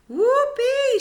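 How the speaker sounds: high, loud whoop of delight; gleeful shout. Acted. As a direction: let it burst out, wild with joy. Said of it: "she shouted".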